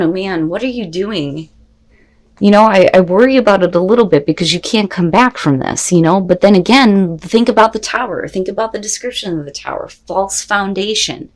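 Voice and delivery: ordinary delivery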